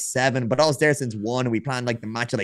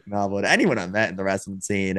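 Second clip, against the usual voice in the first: usual speaking voice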